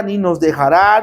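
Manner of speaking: ordinary talk, nothing marked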